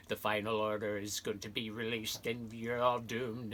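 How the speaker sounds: put-on villain voice booming out a threat; the Emperor. Emperor announcing his return to the whole universe, in a villainous put-on voice. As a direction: announce doom like an evil ruler's proclamation. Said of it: "the Emperor's voice across the universe".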